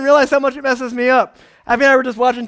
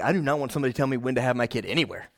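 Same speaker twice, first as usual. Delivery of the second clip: quick inner grumble; 12 semitones lower, 10 dB quieter, much faster than usual. Retold or imitated. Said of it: "I'm like".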